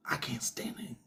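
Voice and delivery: breathy muttered whisper of dislike; whispered grumble. Retold or imitated. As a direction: hiss it quietly to yourself. Quoting a viewer's inner grumble.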